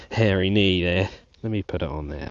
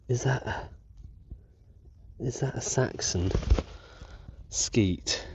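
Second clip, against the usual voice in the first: hushed; 3 semitones higher, 7 dB quieter, slower than usual. Real, not acted. Peering at coin in soil.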